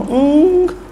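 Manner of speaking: sharp high "Enggak" shouted in denial; emphatic no. Real, not acted. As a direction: fling out the no, incredulous. Preacher denying sahabat did that.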